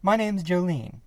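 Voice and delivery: smooth it over after a gaffe; flustered. Acted. Flustered apology, self-introduction.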